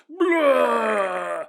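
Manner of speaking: loud, high mock retch; storyteller voices Kenneth throwing up, loud; loud vomit noise. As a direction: belt out a cartoon barf.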